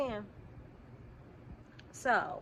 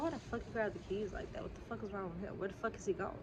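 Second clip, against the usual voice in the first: inner thoughts; 7 dB quieter than usual. Retold or imitated. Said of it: "in my head, I'm like".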